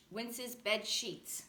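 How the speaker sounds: plain reading voice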